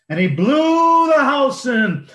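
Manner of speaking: high and loud, building up; dramatic climax. A narrator's reading. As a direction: burst out the collapse. House blown down.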